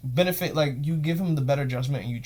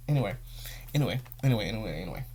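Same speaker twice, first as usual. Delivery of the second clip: "anyway" repeated, softer and lower until it fades; fading anyway; 6 semitones lower, 6 dB quieter, much faster than usual. Real, not acted. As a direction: let "anyway" trail off and drop. Trailing off, dismissing topic.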